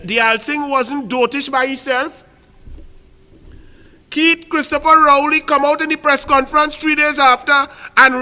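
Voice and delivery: loud, high indignant tirade; outraged. Real, not acted. Speaker denouncing the leader's ridicule of voodoo, outraged. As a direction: rail against the insult.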